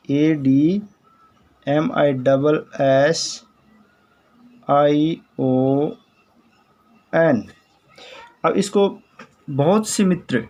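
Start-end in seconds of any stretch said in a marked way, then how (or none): 0.1-7.4 slow, deliberate spelling for students. Shown as a demonstration. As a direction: spell it out letter by letter, slowly. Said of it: "spelling the word out letter by letter".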